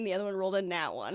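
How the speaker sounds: usual speaking voice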